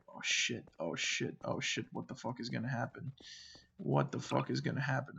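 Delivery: frantic whisper, then a soft mutter; whispered panic. Retold or imitated. Freaking out alone with the dog at night: panicked whisper. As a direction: whisper it, scared of what comes next. Retold freaking out in dark room.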